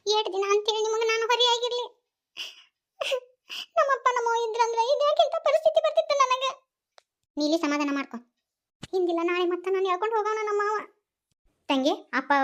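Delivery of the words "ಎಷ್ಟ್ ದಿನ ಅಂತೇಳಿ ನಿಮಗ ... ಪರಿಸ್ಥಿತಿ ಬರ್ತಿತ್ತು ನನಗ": much higher, crying lament; higher voice, weeping over lost parents; tearful crying. Acted. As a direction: plead through tears. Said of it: "ಆ, ಯಾಕ್ ಅಳುದದ?".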